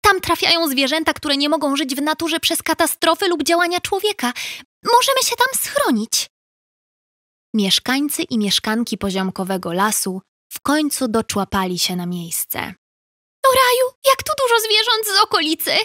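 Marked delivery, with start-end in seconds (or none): 0.0-6.3 high, chirpy urgent little bird; high sparrow pleading urgently. Acted. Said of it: "wróbel Wojtek krzyknął". 13.5-15.7 high, quick delighted wonder; high surprised chatter; amazed exclamation. Acted. Amazed at many animals.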